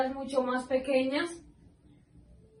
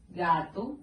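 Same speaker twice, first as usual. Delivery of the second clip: example word; 7 semitones lower than usual. Shown as a demonstration. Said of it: "Ejemplo de esta primera regla sería".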